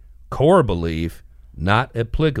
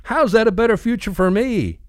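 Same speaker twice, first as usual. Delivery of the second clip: high, louder, indignant comic voice; protesting cartoon guy; 13 semitones higher, 4 dB louder than usual. Man carried off by balloon protests to Rat, high and indignant. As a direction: call out a mock-outraged protest, pitched high.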